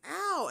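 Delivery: short, high "ow"; imitated pain yelp. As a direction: re-enact a quick yelp of pain. Re-enacts foot grabbed hard.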